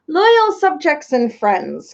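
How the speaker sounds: slow, grand royal address. Acted. King Azaz opening his speech, slow and grand. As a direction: address the hall slowly and grandly.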